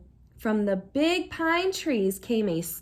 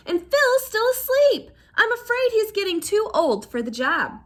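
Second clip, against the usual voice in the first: worried aunt; 9 semitones higher, 5 dB louder than usual. A narrator's reading.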